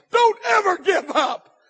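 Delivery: preacher pleading with the crowd; rising preaching shout. Acted. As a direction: lean hard on every word. Repeated exhortation building.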